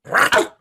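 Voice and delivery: high sharp yelp of pain. Acted. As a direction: cry out as if bitten. Dog bites in acted scene.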